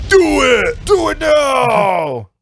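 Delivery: loud, excited shout. Acted. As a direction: yell it at the listeners. Urging listeners, shouting.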